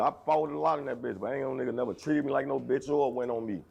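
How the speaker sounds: normal talking voice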